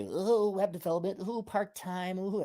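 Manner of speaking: soft, cooing mock enthusiasm; mocking excuse voice. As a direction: quietly coo it in mockery.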